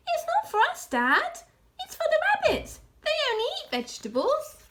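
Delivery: earnest little cub explaining; small fox voice. A narrator's reading.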